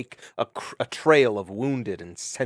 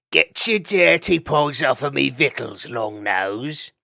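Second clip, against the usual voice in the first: greedy ferret snarl; 3 semitones higher, 5 dB louder, slower than usual. Acted. Ferret snatching food, kicking weasel.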